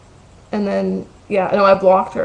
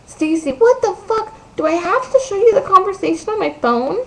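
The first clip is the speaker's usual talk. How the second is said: whiny friend; 12 semitones higher than usual. Retold or imitated. Mocking friend protesting about her phone, exaggerated whine. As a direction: mimic an irritating friend bitching in a squeaky-high voice. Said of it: "in that fucking whiny voice".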